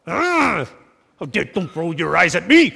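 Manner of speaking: offended at the eye-roll; mock outrage. Spouse offended by eye-rolling, mock indignant outburst. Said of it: "they just roll their eyes and it just gets you".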